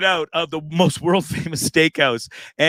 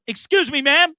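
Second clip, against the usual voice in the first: much higher, called out across a lobby; retold yell; 12 semitones higher than usual. Yelling at the manager across the packed lobby, much higher call. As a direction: call her out so the whole room hears. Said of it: "And I'm yelling across this huge lobby".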